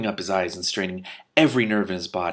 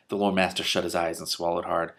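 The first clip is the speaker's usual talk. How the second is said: ordinary delivery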